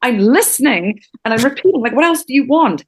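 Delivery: exasperated, stressed-out protest. Retold or imitated. Student ready to pull out his hair protests to his teacher, exasperated. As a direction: push each phrase out in frustration. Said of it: "wanted to pull out his hair".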